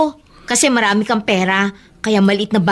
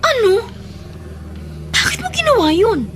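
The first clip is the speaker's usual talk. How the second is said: sharp, much higher, incredulous; shocked outburst; 11 semitones higher than usual. Acted. Carla shocked that he paid the hospital bill, incredulous. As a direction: demand to know why, aghast.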